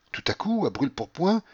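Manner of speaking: ordinary delivery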